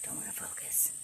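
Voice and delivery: breathy whispered aside. Real, not acted. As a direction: whisper it under your breath. Muttering at the camera focus.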